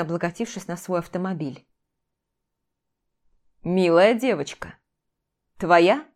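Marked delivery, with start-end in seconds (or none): 3.6-6.0 dispassionate. A narrator's reading. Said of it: "спросил он бесстрастно".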